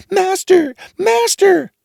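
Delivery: very high shrill magic harp cry; harp calling loud. A narrator's reading. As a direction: shriek for your master. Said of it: "the harp cried out".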